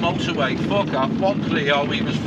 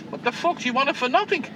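retold angry rant; 7 semitones higher than usual. Taxi driver reliving telling off a stingy fare, rising outraged pitch. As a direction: pitch climbing higher with each phrase, mock outrage.

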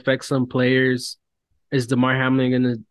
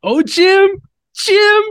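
shrill, much higher, louder excited commentator gush; mocking Tony Romo; 9 dB louder, slower than usual. Podcaster mocks broadcaster Tony Romo gushing over Josh Allen, high loud squeal. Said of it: "Let's move it along, Tony".

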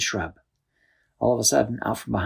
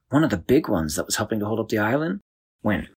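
ordinary delivery, nothing marked

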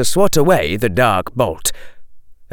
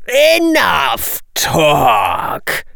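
very slow, higher-pitched villain's order; menacing bully; 6 semitones higher, much slower than usual. Acted. Jugson cuts Lee short, menacing. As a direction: cut the chatter off, dragging each word.

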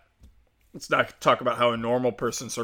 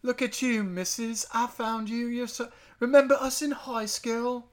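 high, soft Southern voice drifting into British; badly faked Southern accent, pitched way up; botched Southern accent; 10 semitones higher, 3 dB quieter than usual. Friend greets her old schoolmate in a failed Southern accent. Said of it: "like, a Southern accent".

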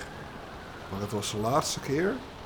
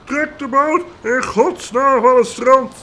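much higher and louder, slow, roaring; bellowing boatsman; 13 semitones higher, 10 dB louder, slower than usual. Acted. Boatsman roaring at men to drag the boat, loud and high. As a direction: haul them with your voice. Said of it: "brulde hij".

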